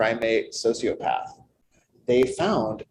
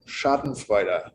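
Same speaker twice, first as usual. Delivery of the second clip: German pronunciation; 4 semitones lower, slower than usual. Shown as a demonstration. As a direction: say it as a careful German word, slowly. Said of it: "I will try to speak German".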